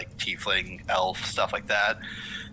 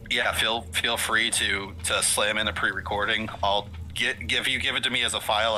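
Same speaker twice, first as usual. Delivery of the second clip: ordinary delivery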